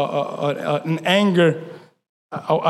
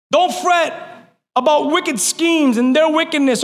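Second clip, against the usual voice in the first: preaching at full force; 9 semitones higher than usual. Acted. Pastor urging the church, preaching at full force. Preaching continues, high pitch.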